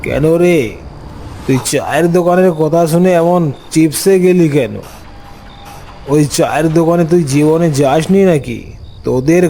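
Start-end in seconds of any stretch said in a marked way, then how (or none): none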